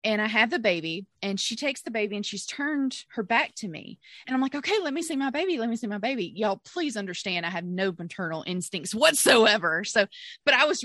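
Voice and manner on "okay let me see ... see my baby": quick, insistent retold plea; urgent plea. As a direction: press the midwife impatiently.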